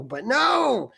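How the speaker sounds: ordinary delivery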